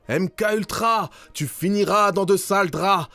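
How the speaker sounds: high-pitched protesters' cry; crowd crying out. Acted. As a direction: call it out high, like an angry crowd taunting a leader. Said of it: "Ces gens criaient". (cri aigu de foule en colère)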